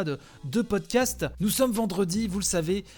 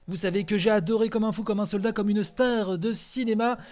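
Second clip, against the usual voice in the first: ordinary talk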